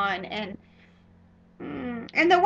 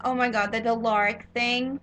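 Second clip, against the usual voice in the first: ordinary talk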